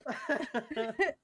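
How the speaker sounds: loud, very high laughing exclamation; overcome, voice shooting up; helpless laughter. Real, not acted. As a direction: squeal it out mid-hysterics. Another burst of the laughing fit.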